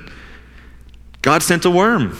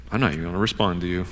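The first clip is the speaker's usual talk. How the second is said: low, quick, much softer retold aside; sullen refusal; 7 semitones lower, 9 dB quieter, much faster than usual. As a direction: imitate a sulky refusal under the breath. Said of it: "was silence from Jonah".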